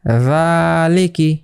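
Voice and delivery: রালিকি drawn out slowly, the long a held one beat; one alif stretch. Shown as a demonstration. Teacher showing the class how to read রালিকি slowly. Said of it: "নিচেরটা কি বললাম".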